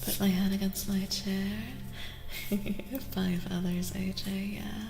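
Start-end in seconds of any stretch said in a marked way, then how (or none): 1.5-3.1 quiet little laugh; soft chuckle. Real, not acted. Streamer settling head against the chair, soft chuckle. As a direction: laugh softly to yourself.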